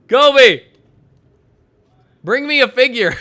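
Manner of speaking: loud, high yell for someone; calling out. Real, not acted. Calling someone to bring a figure, calling out. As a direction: holler it across to them.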